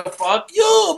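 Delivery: high-pitched, drawn-out mimicry; hammed-up reply. Classmate answering the friends with his catchphrase, hammed-up. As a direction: sing it back high and hammy. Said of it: "And he'd go".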